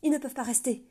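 high, loud yelp; man yelping a refusal. A narrator's reading. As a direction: yelp it out in alarm. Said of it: "glapit-il".

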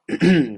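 loud throat clear. Real, not acted. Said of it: "Scusate un po' per la voce".

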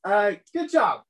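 high-pitched, mocking villain voice; haughty, high put-on voice full of scorn; contemptuous, proud. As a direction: sneer a smug farewell from on high. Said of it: "voice full of contempt and pride".

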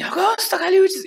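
high excited shriek of his name; high-pitched fan squeal; friends shrieking. Retold or imitated. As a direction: squeal it like friends spotting news. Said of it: "os meus amigos, tipo assim".

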